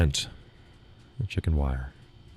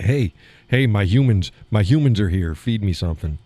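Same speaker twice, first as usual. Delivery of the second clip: loud, higher, eager chicken greeting; 4 semitones higher, 6 dB louder than usual. Pretend. Chickens run to the fence calling their humans, loud and eager. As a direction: squawk like hungry hens spotting their humans.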